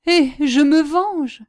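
high, loud and drawn-out declaration; vengeful outburst. A narrator's reading. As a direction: proclaim your revenge, slowly and forcefully. Villain cries out his revenge. (cri de vengeance, lent et fort)

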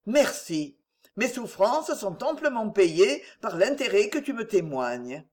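ordinary delivery

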